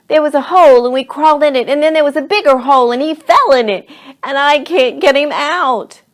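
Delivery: high, loud, tumbling account; panicked explaining. A narrator's reading. Charlie tells Mom about the fall, panicked and loud. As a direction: blurt it all out urgently.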